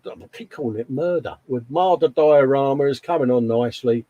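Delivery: usual speaking voice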